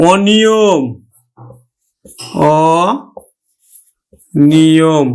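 অনিয়ম slowly, with a held open অ; drawn-out, separated অনিয়ম; open vowel, syllabified. Shown as a demonstration. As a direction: stretch অনিয়ম into অ and নিয়ম. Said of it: "অটা অ এর মতোই উচ্চারণ".

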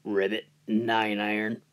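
low, soft croaking frog. Acted. Golfer hears a frog on the green, low soft frog voice. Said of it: "I hear the frog say".

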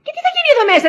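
high, loud outburst; indignant protest. Acted. Wife objects to the household arrangement, loud and indignant. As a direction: voice leaps up in protest.

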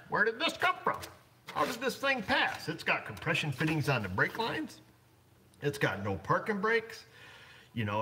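the cop finding the fake sticker, outraged; imitating the cop. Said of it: "the cop does, and says".

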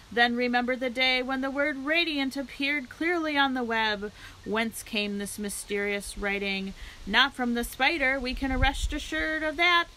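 lightly voiced loudspeaker address to the crowd; a hint of a county fair announcer. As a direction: present the pig like a showman.

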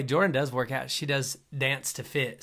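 ordinary talk, nothing marked

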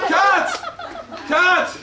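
high, loud shout; director's cut call. Acted. Director ending a chaotic take, high loud shout. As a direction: shout to halt the scene.

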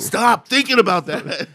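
high, loud comic order; mock scolding. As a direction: order a friend off, half joking. Acted order during imagined brain meld.

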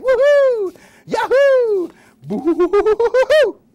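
very high, loud whooping; wild whoops. Acted. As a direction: whoop while leaping. Jumping over the candle.

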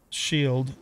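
usual speaking voice

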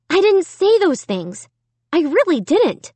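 high-pitched, pleading protest; distressed denial. A narrator's reading. Amy protests to herself.

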